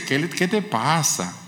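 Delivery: tender Jesus. Acted. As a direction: ask gently, like a friend who notices. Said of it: "sino que dice". (Jesús tierno, suave y pausado)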